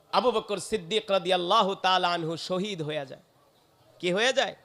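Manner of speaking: usual speaking voice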